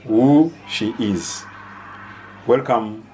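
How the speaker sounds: ordinary delivery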